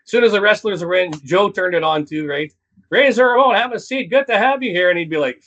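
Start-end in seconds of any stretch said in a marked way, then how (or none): 2.9-4.9 wrestling commentator. Retold or imitated. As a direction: hype it up like a ring announcer. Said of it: "wrestling announcer voice coming".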